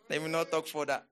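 normal talking voice

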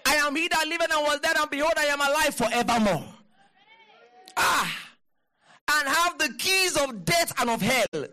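high, forceful proclamation; fervent preaching. Real, not acted. Preacher repeating the verse to the congregation, high fervent proclamation.

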